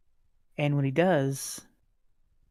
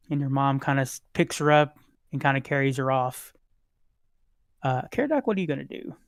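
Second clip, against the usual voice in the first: normal talking voice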